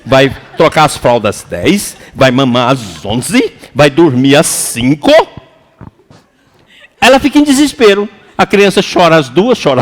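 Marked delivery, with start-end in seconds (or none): 0.1-5.1 lower, slow dictating to a newborn; mock schedule to baby. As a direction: dictate the baby's timetable, half joking. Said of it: "não tem jeito de falar assim".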